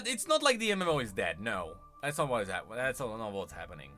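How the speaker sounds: ordinary talk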